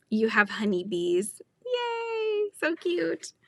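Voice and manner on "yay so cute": high, drawn-out happy squeal; delighted cheer. Real, not acted. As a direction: coo with delight, pitched way up. Delighted reaction to viewer's honeybees.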